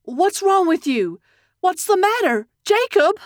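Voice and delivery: high, alarmed questioning, rising in urgency; panicked mother calling to her son; horrified mother. A narrator's reading. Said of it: "with a look of horror".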